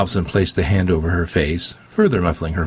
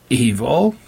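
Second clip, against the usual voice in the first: higher, rising incredulous question; mocking echo; 6 semitones higher than usual. A narrator's reading. Preacher scoffs at the girl's accusation, mocking echo. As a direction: throw the word back as a question.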